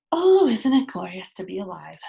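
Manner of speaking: loud, joyful cry of delight; delighted exclaim. A narrator's reading. Pippi on sunny steps with friends, calling out delighted. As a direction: burst out happily at the sunshine.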